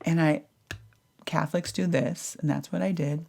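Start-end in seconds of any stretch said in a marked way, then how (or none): none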